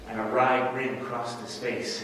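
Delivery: ordinary delivery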